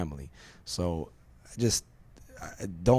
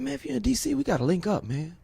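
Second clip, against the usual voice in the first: much higher voice for the buddy, easing down at the end; friend's invitation. Retold or imitated. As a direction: imitate the friend eagerly asking to meet. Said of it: "He said".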